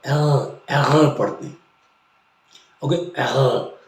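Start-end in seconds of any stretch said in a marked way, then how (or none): 0.1-1.6 अगर with the r from the throat; अगर in German, r rolled back in the throat. Shown as a demonstration. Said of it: "आर को आर जो है वो याद रखिए नीचे साइड से निकलता है".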